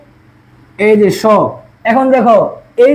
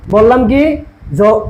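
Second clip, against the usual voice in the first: usual speaking voice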